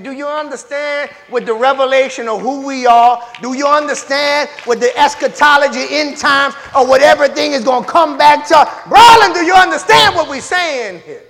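a preacher climbing to a shout; preaching at full force. Real, not acted. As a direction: drive the question home to the congregation.

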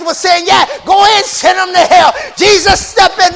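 hoarse chanted sermon, loud and high; whooping preacher. Acted. Preacher whooping to the congregation, loud and high.

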